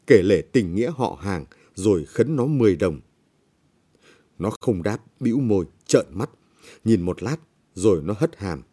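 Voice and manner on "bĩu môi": plain reading voice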